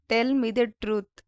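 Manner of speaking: "Tell me the truth" said slowly, each word clear; slow it down for learners; slow, careful repeat. Shown as a demonstration. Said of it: "teacher repeats the phrase slower". (నెమ్మదిగా, స్పష్టంగా)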